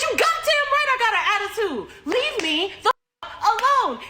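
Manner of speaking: high-pitched, indignant retort; exasperated outburst. Retold or imitated. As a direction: blow up at someone who will not leave. Retells snapping back at the man.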